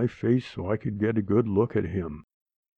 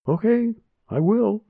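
put-on child voice; 12 semitones higher than usual. Child answers grandma.

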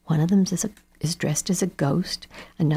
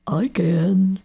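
scarecrow voice; 3 semitones higher, 5 dB louder than usual. Acted. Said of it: "said the scarecrow".

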